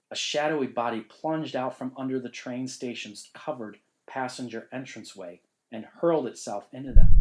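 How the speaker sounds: ordinary delivery, nothing marked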